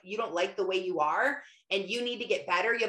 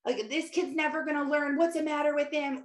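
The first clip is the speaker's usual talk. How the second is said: lightly acted indignant venting, higher; angry husband; 6 semitones higher than usual. Husband reacting to the call about his son, mock angry. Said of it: "He's angry".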